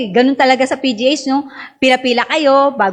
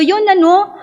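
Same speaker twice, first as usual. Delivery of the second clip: normal talking voice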